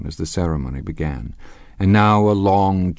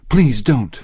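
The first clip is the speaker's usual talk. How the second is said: higher, louder anxious interruption; helpless plea; 7 semitones higher, 6 dB louder than usual. A narrator's reading. Wife tries to stop the confession, helpless plea. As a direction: break in, pleading. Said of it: "she interrupted helplessly".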